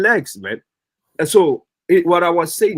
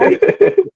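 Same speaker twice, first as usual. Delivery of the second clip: sudden high laugh; a high, amused laugh bursting out; burst of laughter; 4 dB louder than usual. Real, not acted. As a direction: crack up at the insult. Laughs at old man jab.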